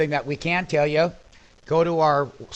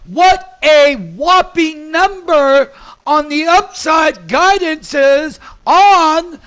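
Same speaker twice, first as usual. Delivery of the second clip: much higher, louder, drawn-out shout of joy; ecstatic shout; 10 semitones higher, 6 dB louder, slower than usual. Real, not acted. Trader announcing upside guidance to viewers, ecstatic. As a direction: stretch each word out in disbelief.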